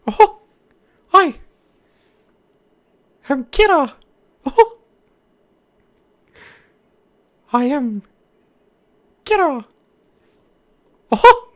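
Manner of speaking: very high, loud cartoon squeak; silly squeaky voice. Streamer testing if they can go super high, loud squeak.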